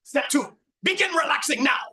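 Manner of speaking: put-on heavy German accent. Record commanding the uptight guy to relax, high loud German accent. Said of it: "with this heavy German accent".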